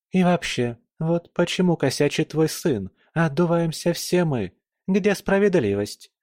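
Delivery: mild grumble, protesting the unfairness; grumbling. A narrator's reading. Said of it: "Продолжила ворчать". (ворчливо, с лёгкой обидой)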